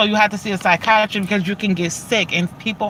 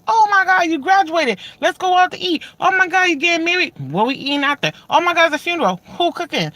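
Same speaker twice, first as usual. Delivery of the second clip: family food excitement; 7 semitones higher than usual. Retold or imitated. Imitating her family turning every event into a meal, excited. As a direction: gush like an aunt about every meal.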